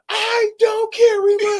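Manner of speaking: high, slow, strained Montel voice; Montel impression. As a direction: stretch it out like Montel. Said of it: "Like Montel".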